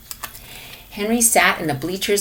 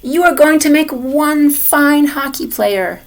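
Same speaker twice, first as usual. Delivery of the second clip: grandma hollering; 5 semitones higher, 5 dB louder than usual. A narrator's reading. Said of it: "Grandma hollered".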